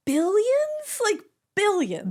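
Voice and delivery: high-pitched, disbelieving question; incredulous. Retold or imitated. Viewer reacting to Succession's casual deal talk, incredulous. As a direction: squeal it in mock astonishment. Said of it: "And I was like".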